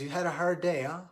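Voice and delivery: lightly voiced old man checking on someone; old fellow. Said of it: "the little old fellow says".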